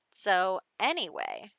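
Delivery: normal talking voice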